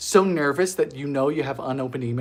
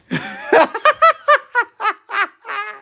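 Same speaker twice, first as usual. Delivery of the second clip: loud high-pitched laughter; 5 dB louder than usual. Real, not acted. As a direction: burst out laughing. Laughing at her own game.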